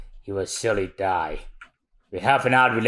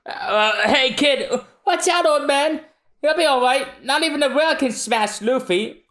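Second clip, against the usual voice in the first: put-on much higher, brash confident kid; high cocky boy voice. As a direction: reassure the old man with bravado. Voicing young hero character.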